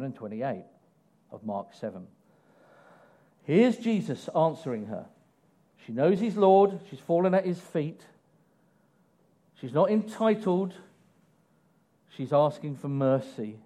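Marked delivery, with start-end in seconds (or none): none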